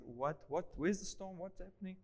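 very soft, half-asleep mumble; just woken, barely voicing the words; drowsy. Jesus woken up in the boat, drowsy. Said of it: "drowsy and say".

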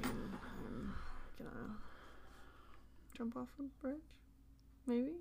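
muffled behind a hand; hand over mouth. Real, not acted. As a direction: talk through your fingers. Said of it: "Get your hand away from your mouth".

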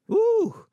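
high, loud delighted ooh; a preacher's squeal of delight; excited ooh. Real, not acted. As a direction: let out a thrilled high ooh.

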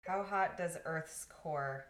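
slow for Siri. Shown as a demonstration. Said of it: "Hey, Siri".

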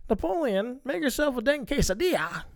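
lightly voiced movie mom. Quoting the mom from Napoleon Dynamite, mock mom voice. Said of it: "if she's like".

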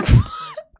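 high loud squeal of laughter; real high-pitched giggle fit. Real, not acted. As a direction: burst into a shrieking laugh. Cracking up retelling.

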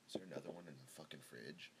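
breathy whisper, no voice at all; muttering whisper about the fridge; whispered aside. Real, not acted. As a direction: whisper it to yourself, off mic. Aside while looking for beer.

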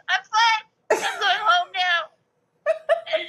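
laughing through embarrassed tears, dragging it out; laugh-crying. Retold or imitated. Fan who just fell down stairs answers her idol, laugh-crying.

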